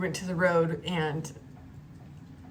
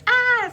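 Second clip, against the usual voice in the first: short, high, loud movie scream; mock scream; 11 dB louder than usual. Imitating dumb scary-movie characters, mock scream. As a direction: scream like a careless horror-movie victim. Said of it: "screaming as loud as they can".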